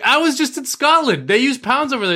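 high, loud and incredulous; hammed-up high-pitched disbelief; mock clueless host. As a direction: squeal it like a baffled TV host. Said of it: "he's like".

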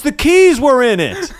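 lightly voiced fire chief; matter-of-fact chief. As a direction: state the obvious to him. Chief explains flatly.

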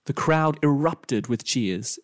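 plain reading voice

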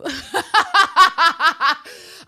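high, loud burst of laughter; real cackling laugh, cracking up; helpless loud laughing fit. Real, not acted. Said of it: "that made me laugh".

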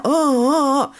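put-on teen wail. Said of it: "they're going".

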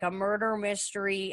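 ordinary talk, nothing marked

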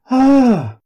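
high, loud startled ah; shocked cry. A narrator's reading. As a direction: cry out in fright.

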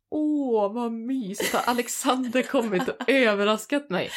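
high, loud, gushing mock delight; delighted surprise. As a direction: act out a happily surprised girlfriend. Acts out delighted first thought. (spelat förtjust och överraskad)

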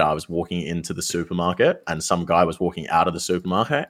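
usual speaking voice

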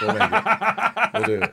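high laughing falsetto, teasing; squeaky high mock promise. Teasing the guest about the next pick, in a squeaky high laughing voice.

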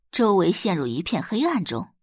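ordinary talk, nothing marked

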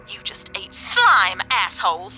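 loud, drawn-out taunt on slime; gloating. Retold or imitated. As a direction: act out the triumphant reveal. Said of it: "And I'll be like".

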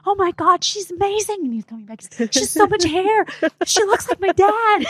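high, delighted rush of praise; gushing dad. Retold or imitated. New father gushing about his daughter, high and delighted. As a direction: gush over the new baby.

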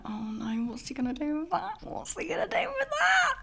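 hushed dread rising to a shrill high squeal; mock panic. Recalling the dildo in his hand, mock panic building to a high squeal. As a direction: play it scared, then squeak in horror. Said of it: "And I'm like".

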